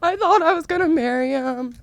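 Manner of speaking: high, slow hammed-up wailing sob; mock-tragic sobbing, milking the drama; dramatic crying. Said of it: "I'm crying at the same time ... dramatically".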